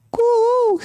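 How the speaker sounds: high, drawn-out sweet coo; cuckoo call. Retold or imitated. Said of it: "your voice is so sweet".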